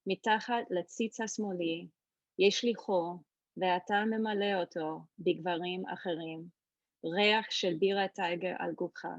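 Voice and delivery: fast, flowing reading in Hebrew; Hebrew poetry reading. Shown as a demonstration. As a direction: read the poem aloud in Hebrew. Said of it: "so you can hear the Hebrew".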